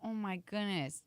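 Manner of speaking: ordinary talk, nothing marked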